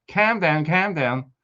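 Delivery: lightly mocked Scouse accent; jokey Scouse catchphrase delivery; Liverpool accent. Jokey Liverpool catchphrase after naming Liverpool.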